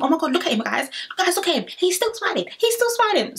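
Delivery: excited. Retold or imitated. Molly quoted, rising excitement.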